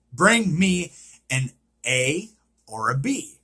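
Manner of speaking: ordinary talk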